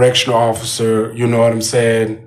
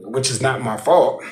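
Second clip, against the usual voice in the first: normal talking voice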